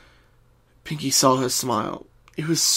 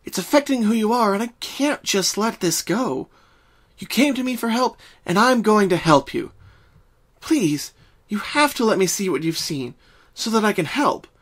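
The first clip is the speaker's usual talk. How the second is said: pleading friend, higher voice; higher, earnest pony friend begging to help; Twilight, gentle; 8 semitones higher than usual. Acted.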